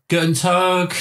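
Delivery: put-on German greeting in a broad Cockney accent. Imitating the Cockney German teacher greeting the class. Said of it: "German teacher that was, like, Cockney".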